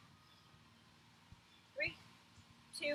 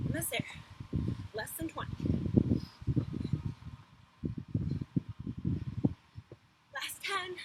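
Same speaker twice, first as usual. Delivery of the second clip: encouraging coach; 6 semitones higher, 7 dB louder than usual. Real, not acted. Coach pushing through last reps.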